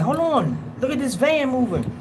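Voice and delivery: high-pitched imitation of a startled reporter; surprised onlooker. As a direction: call out in mock astonishment at a sudden sight. Voices reporters noticing the rocking van.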